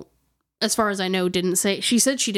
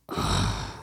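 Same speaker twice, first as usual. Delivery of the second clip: a breathy, airy sigh with no voice; low ghostly sigh; 5 dB quieter than usual. Retold or imitated. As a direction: exhale slowly like a ghost breathing into the mic. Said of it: "a low man's voice go ... breathe like that".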